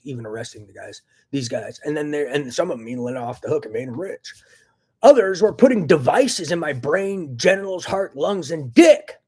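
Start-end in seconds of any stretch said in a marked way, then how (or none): 5.0-9.2 loud, slow angry rant; real anger. Real, not acted. Listing the implants, loud furious rant. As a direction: spit out the list in real fury. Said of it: "I have the right to be really fucking mad".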